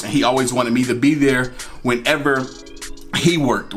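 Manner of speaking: ordinary delivery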